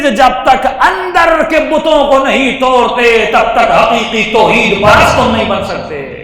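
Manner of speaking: loud, high, slow preaching; fervent exhortation. Real, not acted. Preacher urging his dear listeners, slow loud plea. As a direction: drive the lesson home slowly and loudly.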